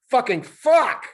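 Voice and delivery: very high, loud strained monkey curse; monkey yelling. Acted. Monkey yells after falling on his face. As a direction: yell it after a hard fall. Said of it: "he yelled".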